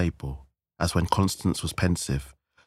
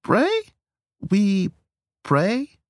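very high, halting questions; great uncertainty; 5 dB louder, much slower than usual. A narrator's reading. Hostess puzzled by offer of prayer, uncertain. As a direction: query each word with a rising squeak. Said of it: "Trina added with great uncertainty".

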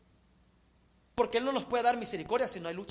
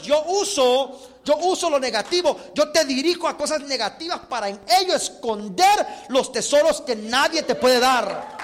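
loud, declaiming God's words from the pulpit; full-force sermon voice; emphatic preaching; 6 dB louder than usual. Acted. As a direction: proclaim it forcefully, stretching key words.

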